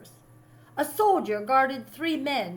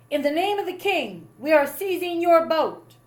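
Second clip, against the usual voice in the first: stern announcement; 6 semitones higher, 7 dB louder than usual. A narrator's reading. Sailor seizing the boys' boat.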